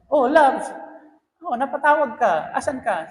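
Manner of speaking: a wife happily picking up her husband's call; wife surprised and glad he called; cheerful wife. Retold or imitated.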